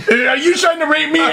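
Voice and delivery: high-pitched mock alarm; paranoid panic. Imagined roofied clubgoer challenging strangers, mock panic. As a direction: accuse them in a comic high panic.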